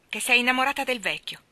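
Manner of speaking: loud, jealous accusation. A narrator's reading. Laurie accusing Jo of loving the professor: loud, angry. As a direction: throw it at her in anger. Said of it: "esclamò furente".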